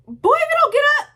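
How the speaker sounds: sudden high, loud, fast scolding yell. Real, not acted. A viewer yelling at a lazy dad on screen, high loud scolding. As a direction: snap at him like an angry mother.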